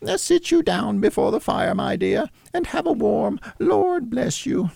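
high falsetto doting mother; Cratchit's wife. A narrator's reading. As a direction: fuss fondly over your daughter, pitched high. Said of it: "said Missus Cratchit".

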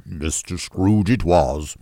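lightly voiced husband, slow; husband confirming. A narrator's reading. Husband confirming his wife's guess, lightly voiced.

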